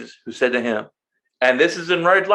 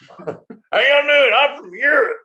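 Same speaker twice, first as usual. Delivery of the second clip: higher, very slow mock European accent; 11 semitones higher, 3 dB louder, much slower than usual. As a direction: drawl a fake European accent to hide. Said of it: "Your accent gives you away".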